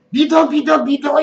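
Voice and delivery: dismissive command. Retold or imitated. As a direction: cut off questions with an insistent, repeated command. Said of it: "질문한다고 하면은". (질문을 막듯 다그치는 흉내)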